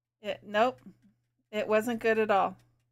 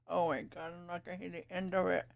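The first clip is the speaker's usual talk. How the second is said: talking around a paintbrush; mumbled, brush between teeth; paintbrush in mouth; 3 semitones lower, 8 dB quieter, faster than usual. Real, not acted. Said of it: "me talking with the paintbrush in my mouth".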